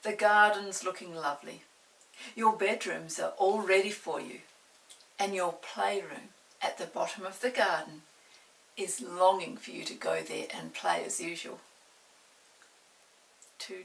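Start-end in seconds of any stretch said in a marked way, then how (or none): 0.0-11.6 loud, hearty mother's welcome; a mother welcoming her children home; cheerful mother. Acted. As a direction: proudly tell them all is ready. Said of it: "said Mother".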